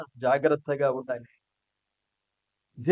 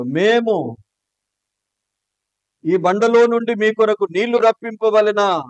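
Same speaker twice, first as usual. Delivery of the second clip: higher, louder, scornful outburst; angry shout; 5 semitones higher, 7 dB louder than usual. Retold or imitated. Moses angrily shouting at the people by the rock. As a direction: hurl the question at them in rage. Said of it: "చాలా గట్టిగా మాట్లాడి ఉంటాడు".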